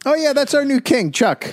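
high-pitched mock introduction of the new king; mock subject. Spanish subject presenting the English king, mock-enthusiastic high voice. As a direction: put on a high voice for a casual subject.

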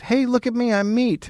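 high, silly cartoon voice; high whiny voice. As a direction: pitch it up like a goofy victim. Mock victim voice.

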